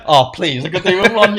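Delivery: loud, playful pretend politeness; mock grateful acceptance. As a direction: play along as an eager customer. Plays along with the joke offer.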